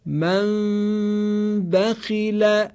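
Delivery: «من» with a lightly hidden nasal m flowing into «بخیل»; hidden m with ghunna. Shown as a demonstration. Teacher repeating the ikhfa example for the class. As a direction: lips barely closed on the m of «من», hum it into «بخیل». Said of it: "توجه کنید".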